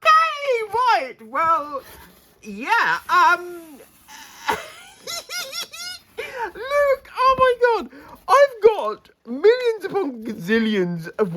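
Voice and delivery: high squeaky excited voice; squealing with excitement. Real, not acted. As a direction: squeal with delight over a gift. Opening surprise gifts, squealing.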